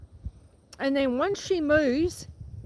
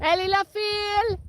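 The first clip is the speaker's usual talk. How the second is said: high cow call; 7 semitones higher, 3 dB louder than usual. Real, not acted. Farmer calling her cows in French across the wind. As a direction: sing it out to the herd. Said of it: "I am losing my voice".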